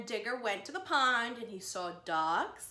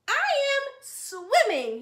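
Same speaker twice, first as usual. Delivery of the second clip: higher, louder, slow proud announcement; proud Digger; 12 semitones higher, 10 dB louder, slower than usual. Acted. Digger boasts to the duck, high slow proud voice. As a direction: show off like a thrilled little animal.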